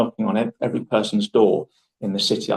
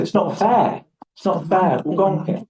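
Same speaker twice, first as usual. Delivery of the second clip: higher, hammed-up childish complaint; theatrical whining; 8 semitones higher than usual. Telling girlfriend at karaoke, mock whiny complaint. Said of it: "I'm being obviously theatrical".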